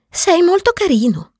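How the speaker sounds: put-on high, young boy's voice; a lightly voiced little prince; childlike prince voice. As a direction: speak up brightly like a small prince. Prince compliments the fox.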